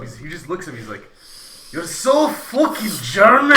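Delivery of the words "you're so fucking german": slow, loud mock Scottish accent. Drunk Scottish pal stares at the guest, mock Scottish accent. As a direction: draw it out high in a hammed-up Scottish accent. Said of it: "You have to do the accent".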